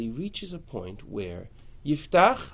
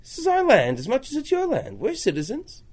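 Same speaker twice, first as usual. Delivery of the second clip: indignant complaint; 13 semitones higher than usual. Acted. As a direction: demand why they were left out. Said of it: "he comes to me and says".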